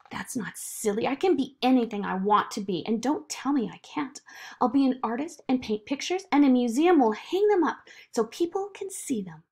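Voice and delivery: lightly voiced insistent girl; protest, then build into eager plans; Zoe, indignant child. Zoe objecting to being called silly, lightly indignant.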